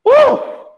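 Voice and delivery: high, loud whoop of relief; relieved whoop. Retold or imitated. Someone freed of a problem, loud high whoop of relief. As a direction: let out a sharp happy whoop. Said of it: "Você fala assim".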